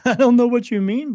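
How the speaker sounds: a bewildered laugh through the words; laughing disbelief. Real, not acted. Baffled by the medium, laughing in disbelief.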